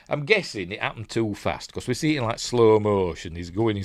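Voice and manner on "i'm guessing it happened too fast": normal talking voice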